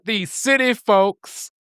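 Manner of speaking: high, drawn-out mock Southern local. Friend teases the city visitors as a drawling local, high and slow. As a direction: stretch it out teasingly like a local.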